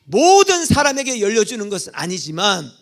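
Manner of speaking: loud, high blessing proclaimed from the pulpit; fervent preaching. Acted. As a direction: lift the congregation with a rousing blessing. Preaches blessing at full force.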